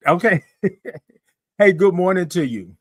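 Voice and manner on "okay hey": usual speaking voice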